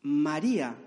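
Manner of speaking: low, gentle call by name; Jesus. Acted. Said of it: "reconoció el tono de voz de su maestro".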